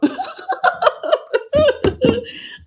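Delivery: long, high-pitched laugh; real laugh. Real, not acted. Streamer joking about JP, long high laugh. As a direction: keep laughing high at the joke.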